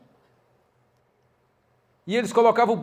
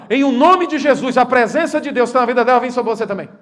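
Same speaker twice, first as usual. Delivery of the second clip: proclaiming blessing; 4 semitones higher, 6 dB louder than usual. Retold or imitated. Pastor blesses manager.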